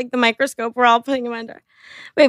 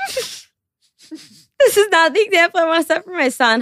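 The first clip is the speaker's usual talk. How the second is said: mocking Justin; 5 semitones higher than usual. Mocking Justin's apology line.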